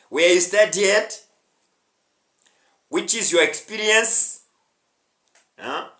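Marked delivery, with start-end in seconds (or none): none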